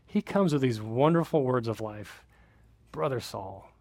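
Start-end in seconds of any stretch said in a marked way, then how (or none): 2.9-3.7 soft repeat. Real, not acted. Quiet savoring repeat.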